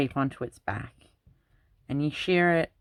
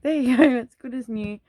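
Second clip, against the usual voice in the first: high, bright, loud sing-song comfort; reassuring mum; 11 semitones higher, 6 dB louder than usual. Retold or imitated. Mum and nana cleaning the helmet, cheerily reassuring the upset child in a high voice. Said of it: "and they're like".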